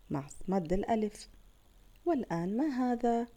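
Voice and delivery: ordinary delivery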